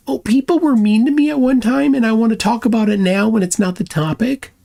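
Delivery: high, whining mock of the opponent; mocking whiny child. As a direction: whine it like a sulking kid. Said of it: "That's what babies do".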